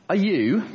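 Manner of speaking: "you" hit high and loud with a rising question; accusing high priest pressing on "you"; stress on you. Shown as a demonstration. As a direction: lean hard on "you" and lift it up. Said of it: "stress on the word you".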